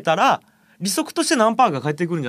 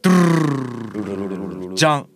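low rolled-tongue drumroll into "jan!"; mouthed drumroll; 8 semitones lower than usual. Pretend. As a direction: build suspense with a vocal drumroll, then reveal. Imitated drumroll before revealing amount.

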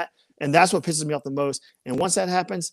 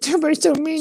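high-pitched mocking whine; mock whining; 10 semitones higher, faster than usual. Retelling someone's complaint to friends, mock whiny. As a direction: whine it like a wronged child, mimicking.

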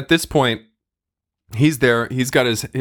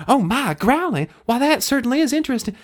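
Darcy's voice; 10 semitones higher, faster than usual. Acted. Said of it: "And Darcy".

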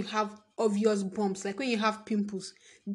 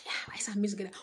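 whisper; 4 dB quieter, much faster than usual. Real, not acted. Whispered aside about food.

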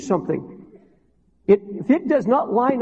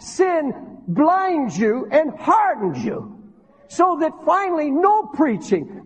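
high-pitched, impassioned pulpit delivery; urgent, fervent sermon climax; full-force preaching; 7 semitones higher than usual. Real, not acted. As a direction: drive the warning home, stretching key words.

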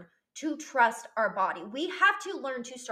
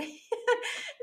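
light high laugh bursting out; a happy rising laugh; real laugh; 13 semitones higher than usual. Real, not acted. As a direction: laugh at the memory. Laughing while recalling.

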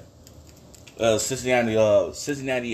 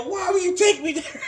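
much higher, half-laughing, half-crying whine; laughing mock complaint. Complaining to his friend about the restaurant, laughing through it. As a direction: ham up the outrage while cracking up.

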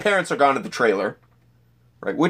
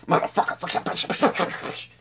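whispered string of curses under the breath; silent swearing; 7 semitones higher, 4 dB quieter than usual. Retold or imitated. Stressed mover on moving day, swearing in a whisper. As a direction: hiss the curses without voice, just breath. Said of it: "I'm silently going".

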